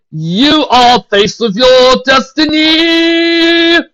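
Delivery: high, slow grand announcement; grand proclamation. Acted. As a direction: proclaim it like destiny.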